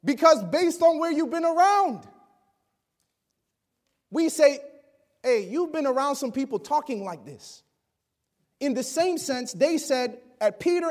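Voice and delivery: loud, rising preacher's cry; full-force preaching. Acted.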